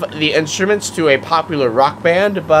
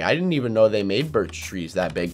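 ordinary delivery

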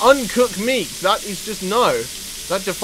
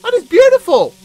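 very high, loud squeal of delight; elated exclaiming; 7 dB louder than usual. Real, not acted. Gamer seeing meat perfectly cooked, high elated squeal. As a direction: crow over it in a soaring pitch.

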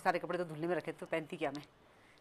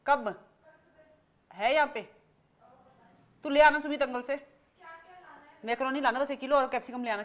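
raised, higher voice giving orders over the phone; loud phone talk; 8 semitones higher, 8 dB louder than usual. Real, not acted. Telling someone on the phone what to bring, loud and high. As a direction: talk loud so the caller hears the shopping list.

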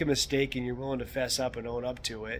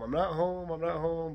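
higher voice, trying to be heard; retold call through a doorbell, pitched up; 6 semitones higher than usual. Host tries to tell neighbor through doorbell cam, calling out.

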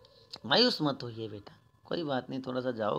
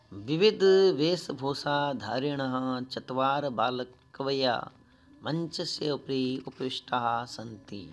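slow, louder chanted reading of the text; Sanskrit recitation; 6 dB louder, slower than usual. Shown as a demonstration. Teacher reciting the Sanskrit text to pupils, slow and loud. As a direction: recite the Sanskrit line slowly and loudly.

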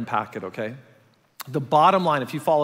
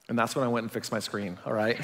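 a hint of wry wife; 7 semitones lower, 7 dB quieter than usual. Wife gently overruling husband about the cracked screen, wry. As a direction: lower and softer, teasing with a quirky smile. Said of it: "she's like, quirky smile".

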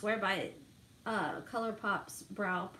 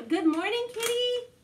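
much higher, sing-song pet voice; greeting the cat; 12 semitones higher, 6 dB louder than usual. Real, not acted. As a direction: coo good morning to your cat.

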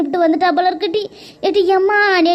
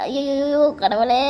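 slow pained wail; 4 semitones lower, 4 dB quieter, much slower than usual. Acted. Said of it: "வலிக்குது அம்மா".

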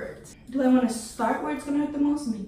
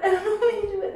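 high, louder wail of dread; loud panicked wail; 10 semitones higher, 6 dB louder, much faster than usual. Real, not acted. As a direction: wail it out at full volume. Panicking before pulling strip.

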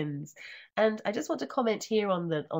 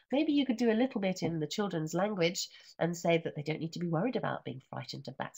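ordinary delivery

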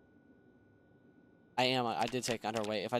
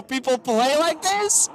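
disbelief; 8 semitones higher, 4 dB louder than usual. Real, not acted. Stunned by the game.

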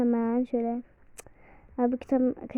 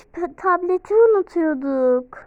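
usual speaking voice

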